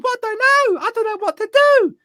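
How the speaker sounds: very high-pitched mocking wail; exaggerated falsetto panic; mimicked helpless drivers. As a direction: squeal in mock helplessness.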